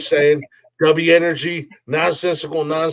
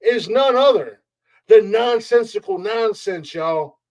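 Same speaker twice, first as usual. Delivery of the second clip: high, slow ring announcer hype; wrestling announcer; 6 semitones higher, slower than usual. Acted. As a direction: drag out the champ's intro.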